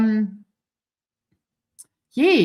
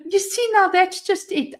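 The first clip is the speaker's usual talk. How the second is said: ordinary talk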